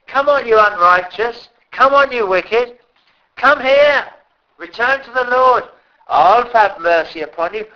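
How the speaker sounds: high, loud pleading call to come home; God calling sinners. Acted. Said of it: "But God says".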